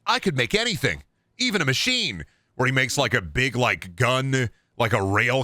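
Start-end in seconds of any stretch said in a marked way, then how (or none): 0.0-2.2 put-on higher-pitched boastful kid voice; genius kid. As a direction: pitch it up like a cocky kid inventor. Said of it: "Where the kid's like".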